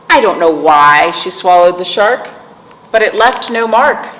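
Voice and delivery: sing-song rhythmic read-aloud; reading to children. Acted. Teacher reading a picture book to kids, sing-song. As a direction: lilt the rhyme for little listeners.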